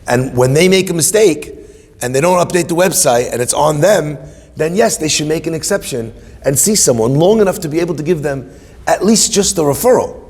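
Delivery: pressing his point hard; indignant demand. Retold or imitated. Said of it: "I was like".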